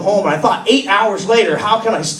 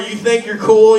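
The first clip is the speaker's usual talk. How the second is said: ordinary talk, nothing marked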